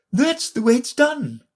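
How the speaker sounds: high, firm decided Queen; with great decision. A narrator's reading. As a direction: settle it briskly and surely. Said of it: "with great decision".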